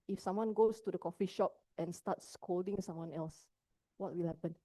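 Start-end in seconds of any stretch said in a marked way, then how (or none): none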